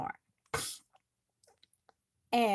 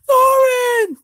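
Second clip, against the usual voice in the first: high, loud call of his son's name; cried out; 14 semitones higher, 13 dB louder than usual. Acted. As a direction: shout his name in joyful relief. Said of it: "Thrain cried out".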